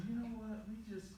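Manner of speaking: very soft, low, apologetic; hushed bad news. Boss letting an employee go, hushed apology. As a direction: let it sink to a near-mumble. Acted boss laying someone off.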